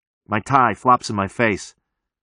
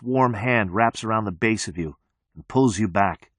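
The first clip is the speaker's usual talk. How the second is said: plain reading voice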